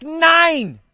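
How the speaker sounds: ein hohes, lautes Nein. Acted. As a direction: entschieden ablehnend rufen. Der junge Mann lehnt Jesus ab. (lautes, schroffes Nein)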